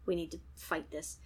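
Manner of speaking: low, quiet, quick urging; a hint of urgent, hushed resolve; lightly voiced English guy. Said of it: "so he's like".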